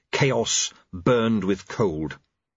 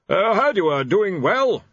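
awkward; 9 semitones higher, 4 dB louder than usual. A narrator's reading. War awkward.

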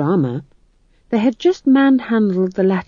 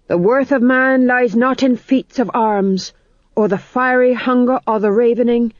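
louder, grand stage-actor speech; dramatic declamation; 5 semitones higher, 3 dB louder than usual. A narrator's reading. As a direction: declaim it like a tragedian. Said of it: "His voice became deeper, his right hand flung out dramatically".

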